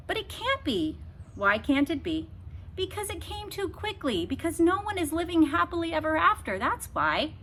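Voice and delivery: put-on high child's protest; upset girl defending her story; girl arguing. A narrator's reading. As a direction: argue for a happy ending. Abilene arguing.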